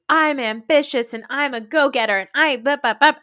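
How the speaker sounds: high, loud mock self-promotion; hammed-up ambitious chirp; imitating go-getter. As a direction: punch out the boast, then babble. Imitating go-getter castmate's boasting.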